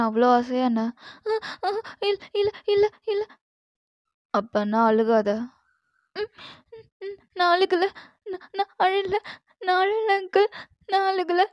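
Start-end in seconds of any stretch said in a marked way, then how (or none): 2.0-3.2 put-on frightened girl pleading. Captive girl begs Jimman to send her home, frightened pleading. Terrified girl denies crying. 7.3-11.4 put-on frightened girl pleading. Captive girl begs Jimman to send her home, frightened pleading. Girl insists she isn't crying.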